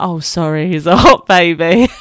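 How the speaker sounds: mock apology giggled out loud; laughing quote. New mum mock-apologising to listeners, laughing. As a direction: laugh through the fake apology. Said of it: "I'm like".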